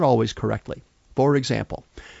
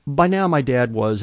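normal talking voice

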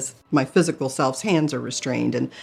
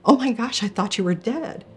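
astonished; 8 semitones higher than usual. Retold or imitated. Shock at seeing dead grandmother.

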